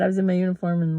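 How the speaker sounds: ordinary delivery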